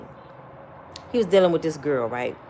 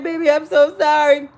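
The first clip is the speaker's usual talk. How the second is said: higher-pitched put-on tearful apology; mock fake crying; 4 semitones higher, 3 dB louder than usual. Brother fake-crying sorry down the phone to his girl, mock sobby. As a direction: imitate a man pretending to cry. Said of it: "fake crying".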